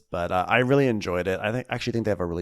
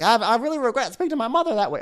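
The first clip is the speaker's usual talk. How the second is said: high, fast whiny imitation of Deandra; mocking Deandra; 13 semitones higher, faster than usual. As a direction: rattle it off like a fake-sorry reality star. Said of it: "She's like".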